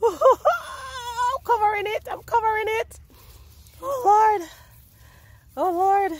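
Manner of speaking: high laughing cries of delight; overjoyed squeal. Real, not acted. Gardener reacting to potatoes in the soil, high laughing squeal. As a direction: squeal it out, barely able to contain the joy.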